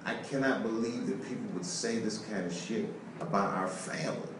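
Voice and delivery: soft, low, hurt voice; hurt quiet wife. Retold or imitated. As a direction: voice his wife, quietly wounded. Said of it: "she says".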